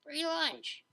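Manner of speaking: Vinyl's voice. A narrator's reading. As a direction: tease her with a grin in the voice.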